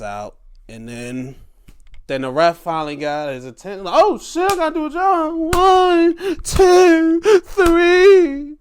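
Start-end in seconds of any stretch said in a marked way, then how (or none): 4.0-8.6 high, loud sudden panic count; hammed-up ref shouting the pinfall; mock frantic ref. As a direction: yell the count like a startled ref.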